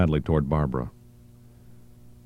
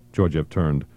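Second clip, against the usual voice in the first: ordinary delivery, nothing marked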